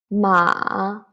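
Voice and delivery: MA dipping, then up; third tone. Shown as a demonstration. Teacher leading learners together, ma dipping.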